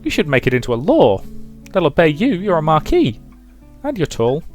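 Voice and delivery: put-on high, quick little girl voice; bright-eyed child, pitched high and fast; chattering child. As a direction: pester a grown-up eagerly.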